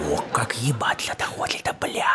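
unvoiced mocking whisper. Real, not acted. The teller muses about the girl, sly whisper. Private thought aside.

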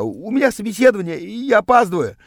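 high, hurried excuse; pleading. A narrator's reading. Narrator late for an interview, pleading with the rival. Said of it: "взмолился я".

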